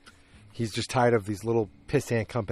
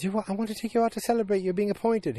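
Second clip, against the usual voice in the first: high put-on voice for an imitated wife; mock-wifely, pitched way up; wife's voice; 11 semitones higher, faster than usual. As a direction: imitate a wife in a raised, quick voice. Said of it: "And his wife says".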